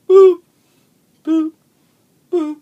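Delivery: high, loud robotic boop; robot beep. Retold or imitated. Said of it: "robots that come in and go".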